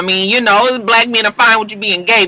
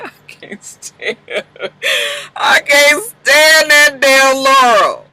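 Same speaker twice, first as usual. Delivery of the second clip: breathy start, then a slow, drawn-out sneer that climbs much higher; disgusted; 9 semitones higher, slower than usual. Retold or imitated. Fan reacting to Laurel's reveal, slow contemptuous drawl. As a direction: spit out the dislike slowly. Hating Laurel while she walks off.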